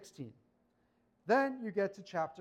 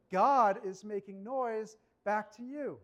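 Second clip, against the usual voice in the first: usual speaking voice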